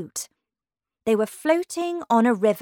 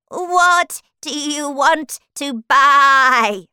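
slow, loud, bleating shopkeeper; old sheep voice; 4 semitones higher, 8 dB louder, much slower than usual. Acted. Old sheep asks Alice in the shop, bleating slowly. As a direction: draw out the words like a sheep.

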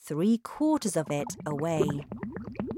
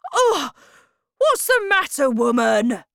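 loud, much higher startled shout; 8 semitones higher, 8 dB louder than usual. Acted. Husband startled by his wife's scream, shouting. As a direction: yell at her, alarmed. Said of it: "Mister Wormwood shouted".

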